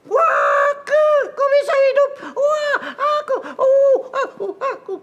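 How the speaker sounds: loud, very high excited squealing; over-the-top astonished puppet squeal; amazed puppet. Acted. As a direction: shriek in amazement as a little puppet. Puppet overreacts to Daniel being alive.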